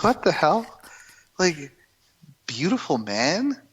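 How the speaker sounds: can't believe she said it; husband mocks his wife's crush in disbelief; incredulous. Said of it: "And I'm like".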